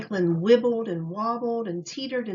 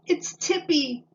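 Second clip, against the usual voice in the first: wobbly little outcry, higher; nervous child crying out as the bike tips; anxious squeak up high; worried cry; 7 semitones higher, 3 dB louder than usual. A narrator's reading. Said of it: "he cried".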